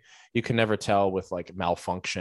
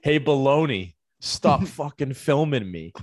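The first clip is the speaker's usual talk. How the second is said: louder, higher mock tough-guy call; puffed-up drunk threat; 6 semitones higher, 4 dB louder than usual. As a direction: puff your chest out and bark it at him. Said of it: "puffs his chest out and goes".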